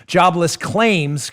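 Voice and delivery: Jobless claims said slowly, leaning on claims; stress on claims. Real, not acted. Host to listeners, underlining the word claims, slowly. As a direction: drag out Jobless claims and hit claims. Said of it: "Why did I emphasize the word claims?".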